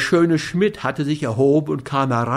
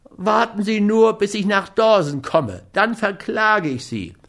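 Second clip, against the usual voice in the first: high-voiced, indignant threatening; whiny threat; 8 semitones higher than usual. A narrator's reading. Schmidt threatens Scott with legal action, whiny menace. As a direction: threaten him in a high, plaintive voice. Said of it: "drohte Schmidt".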